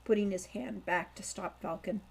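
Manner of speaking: ordinary delivery, nothing marked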